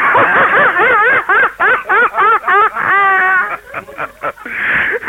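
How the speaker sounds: high shrieking laugh; laughing so hard it almost cries; burst of laughter. Real, not acted. Laughing at the joke caller, high shrieking laugh.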